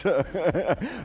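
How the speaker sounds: loud, rising laugh; hearty laugh. Real, not acted. Diplomat laughing loudly after telling his friend's husband to stop. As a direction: laugh hard at the punchline.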